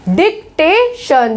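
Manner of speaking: डिक्टेशन slowly, dik-TAY-shun with sh; careful pronunciation. Shown as a demonstration. Teacher models the word slowly for class, sh. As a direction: stretch out डिक्टेशन, sh on the end. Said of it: "देखो डिक्टेशन".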